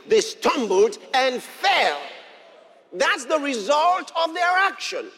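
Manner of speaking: loud, driving sermon delivery; preaching at full force. Acted. Pastor stirring up the congregation, loud fiery preaching. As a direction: preach it out to the whole church.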